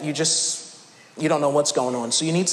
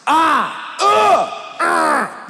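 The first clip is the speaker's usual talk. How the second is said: frustrated groans; 8 semitones higher, much slower than usual. Retold or imitated. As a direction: wail in frustration at the phone menu. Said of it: "You're making sounds like this because you're so upset".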